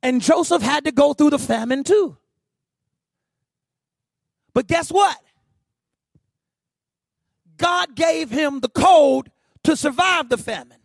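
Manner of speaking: driving sermon delivery; impassioned preaching. Real, not acted. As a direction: press the point home to the congregation. Preaches at full force.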